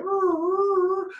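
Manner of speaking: high, loud dog howling; imitated dog howl. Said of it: "the dog would be going".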